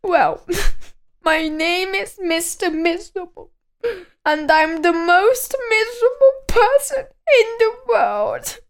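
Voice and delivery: high, weepy, self-pitying whine; miserable whiny voice. A narrator's reading. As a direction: moan it out as the saddest man alive. Mister Miserable introduces himself.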